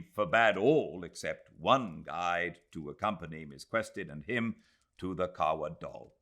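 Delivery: ordinary delivery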